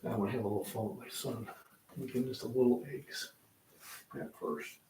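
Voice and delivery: conspiratorial whisper to the camera; hushed aside. Real, not acted. Plotting prank on his son.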